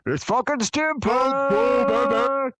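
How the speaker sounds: screaming, very high hype calls; hype man shout. Pretend. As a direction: hype the crowd at full shout. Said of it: "I'd just be hyping you".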